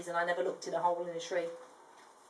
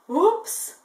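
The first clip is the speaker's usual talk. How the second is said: caught-out oops; 10 semitones higher, 6 dB louder than usual. Acted. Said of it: "thought Crikey".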